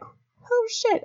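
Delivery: high, soft startled gasp; hushed shocked oh shit. Retold or imitated. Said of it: "And I was like".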